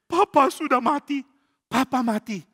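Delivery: high, loud mock wail; crying. Abiatar telling Daud about father's death, crying. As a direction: wail it out in acted grief. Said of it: "kenapa kamu nangis".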